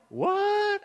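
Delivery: very high mock disbelief; astonished. Preacher mimicking someone whose prayer shows up, astonished. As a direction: squeal it as if you can't believe it. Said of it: "we're, we're astonished".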